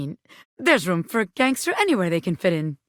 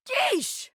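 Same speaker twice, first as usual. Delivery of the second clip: breathy high yelp of relief; high startled yeesh; 5 dB quieter than usual. Acted. Bobby Pin escaping the harpy with a yeesh. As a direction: let out a rattled yeesh.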